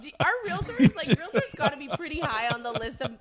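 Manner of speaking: asking through laughter, loud; laughing. Real, not acted. Podcast host asks about realtors, still laughing. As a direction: keep laughing while you ask.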